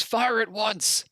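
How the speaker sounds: high, drawn-out commanding shout; high-pitched, stretched-out military yell; screamed battle order. Acted. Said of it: "she screams".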